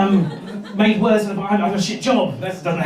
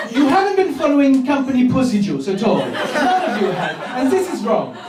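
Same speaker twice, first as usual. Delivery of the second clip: higher-pitched German sales boss; fussy mock-German manager; German accent; 6 semitones higher than usual. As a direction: scold the team in a German accent. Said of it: "is it my accent?".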